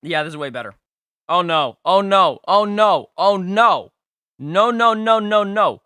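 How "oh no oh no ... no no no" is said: high, loud oh-no wail; loud dismay. Real, not acted. Dismay at a bad team.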